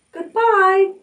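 very high little goodbye; a small voice calling back; tiny spider farewell. A narrator's reading. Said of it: "it said as it sailed".

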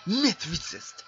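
loud high disbelieving shout; outraged. Real, not acted. Gamer outraged at opponent's stats, shouting. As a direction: yell the number in outrage.

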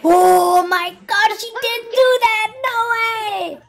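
loud, high outburst of disbelief; stunned, high-pitched reaction; shocked disbelief. Real, not acted. Shocked reaction to video clip.

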